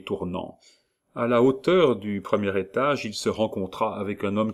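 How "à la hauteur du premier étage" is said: plain reading voice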